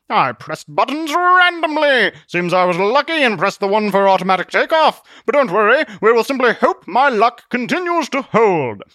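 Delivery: loud, high, theatrical hero voice; blustering Zealot, cheerfully confident. Acted.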